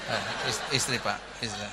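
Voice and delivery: sheepish giggling correction; flustered laughing reply. Retold or imitated. Embarrassed husband to pastor, flustered laugh.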